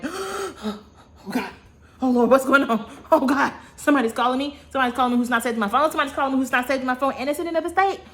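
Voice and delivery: high, loud, rushed panic over a late-night call; panicked. Retold or imitated. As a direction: blurt it out fast and frantic, voice jumping high.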